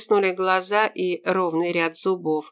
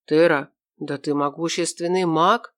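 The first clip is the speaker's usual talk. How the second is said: plain reading voice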